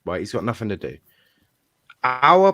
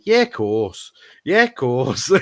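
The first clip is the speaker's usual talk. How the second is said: louder, nodding-along Scouse agreement breaking into a laugh; hammed-up thick Scouse yes-man voice; Steven Gerrard Scouse accent; 3 semitones higher, 7 dB louder, much slower than usual. Gerrard agreeing with the boss, loud laughing Scouse accent. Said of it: "in his thick Scouse accent".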